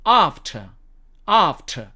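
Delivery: British "after" with silent r; British "after", long ah, no r; British accent. Shown as a demonstration. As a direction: say "after" as British "ahf-tuh". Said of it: "R은 발음하지 않는다고".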